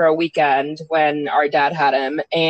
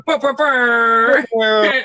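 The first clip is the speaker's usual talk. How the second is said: air horn noise; 5 semitones higher, 3 dB louder, much slower than usual. Pretend. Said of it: "The air hor".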